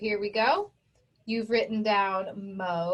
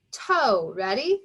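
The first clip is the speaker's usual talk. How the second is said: normal talking voice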